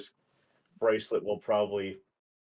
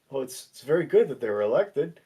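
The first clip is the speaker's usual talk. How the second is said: raised, louder character voice; in-character speech; 5 semitones higher, 8 dB louder than usual. Acted. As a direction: speak up as the character. Player voicing Spencer in-character.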